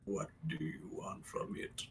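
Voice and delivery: soft unvoiced whisper; whispered construct voice. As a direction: breathe it like a hollow construct.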